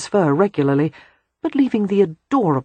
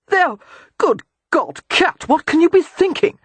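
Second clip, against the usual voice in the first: rising, much higher appalled outburst; 12 semitones higher than usual. Acted. Father appalled to find his babies eating dog biscuits. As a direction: horror climbing as he speaks.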